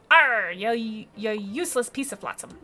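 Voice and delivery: loud piratical growl of frustration; grumpy fish captain. Acted. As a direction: curse it like an old sea dog. Fish captain curses useless shell.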